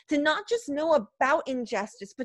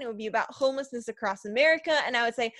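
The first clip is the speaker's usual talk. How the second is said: ordinary talk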